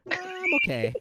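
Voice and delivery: high-pitched retold squeak; mock hesitant reply. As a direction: act out a high, wavering okay. Retold reply to second espresso offer.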